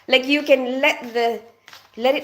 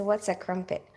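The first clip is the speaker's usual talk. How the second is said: usual speaking voice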